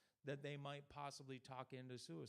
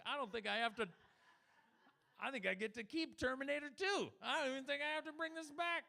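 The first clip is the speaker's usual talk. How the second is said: much higher, louder giddy delight; gleeful realization; 12 semitones higher, 7 dB louder than usual. Pretend. Customer realizing Blockbuster is gone, giddy high glee. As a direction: squeal like you got away with it. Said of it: "And you're like".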